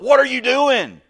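high, loud indignant yell; mock outraged protest. Preacher acting out someone protesting to God, loud mock outrage. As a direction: shout it like an acted complaint to God.

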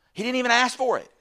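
high, loud burst of astonishment; amazed exclamation. Real, not acted. Preacher marvelling at an unasked gift from God, amazed shout.

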